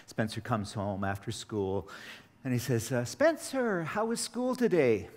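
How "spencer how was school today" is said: bright, much higher parent voice; cheery parent. Retold or imitated. A father asking his son about school, in a high, cheery voice. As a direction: chirp the question like an eager parent.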